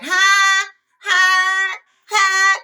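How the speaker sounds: high, loud, sung hi, overdone; mocking grandma's sing-song hi. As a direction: sing out hi like a fake-sweet grandma. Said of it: "she would be like".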